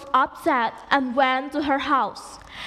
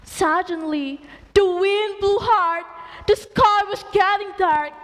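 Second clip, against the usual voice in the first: ordinary delivery, nothing marked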